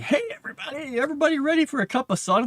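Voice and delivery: high, fast cartoon mascot voice; bouncy, eager to please; cheery mascot. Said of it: "the mascot runs in and is like".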